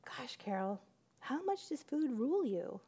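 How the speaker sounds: soft, hushed gentle question; quiet. Retold or imitated. God gently asking Carol what rules her, quiet. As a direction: ask it softly, almost under the breath. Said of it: "so quietly".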